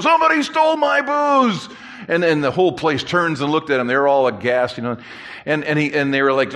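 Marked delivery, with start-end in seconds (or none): 0.0-1.7 mock yell, pitched way up; imitated drunken yell. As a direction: holler it like an accusing drunk. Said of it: "'cause he's yelling".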